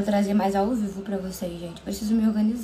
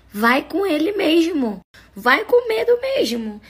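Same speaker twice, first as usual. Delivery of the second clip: rousing push; 7 semitones higher, 4 dB louder, slower than usual. Real, not acted. Urging fearful followers.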